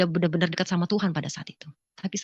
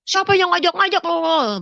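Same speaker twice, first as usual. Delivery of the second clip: loud, high retort, drawn out; angry challenge; 10 semitones higher, 6 dB louder, slower than usual. Retold or imitated. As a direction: act out a defiant shout at an in-law. Husband fighting back at mother-in-law.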